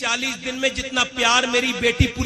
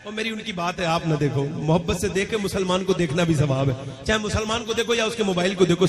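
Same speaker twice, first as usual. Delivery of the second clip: usual speaking voice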